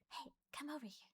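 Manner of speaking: very soft, secretive summons; hushed beckoning. Retold or imitated. Coworker quietly calling her aside, hushed beckoning. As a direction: call someone over under your breath.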